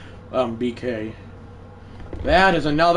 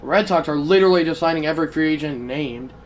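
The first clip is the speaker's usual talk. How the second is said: ordinary talk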